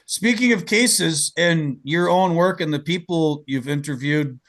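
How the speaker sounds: ordinary talk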